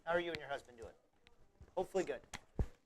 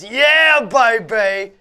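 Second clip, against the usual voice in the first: put-on high, loud excited "yeah baby"; impression voice; 5 semitones higher, 14 dB louder, much slower than usual. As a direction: shout it like a wild party guy. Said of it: "Galaxy says".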